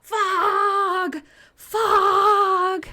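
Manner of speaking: high, loud calling out Fog; mock scream. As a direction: shout like a lighthouse keeper. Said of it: "he screams".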